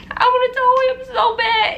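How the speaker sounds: crying. Real, not acted. Recalling holding her newborn, she breaks down crying. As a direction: voice breaking high into tears. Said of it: "oh, shoot, I'm acting like a".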